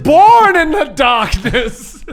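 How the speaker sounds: hammed-up villain; villain monologue. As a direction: declare it slowly like a grand villain. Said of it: "You're seriously monologuing right now?".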